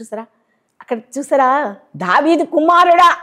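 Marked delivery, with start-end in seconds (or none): 2.0-3.2 higher, louder call of Son of David. Retold or imitated. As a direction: call out to Jesus across the crowd. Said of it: "అని పిలవగానే". (గుడ్డివాడి పిలుపు)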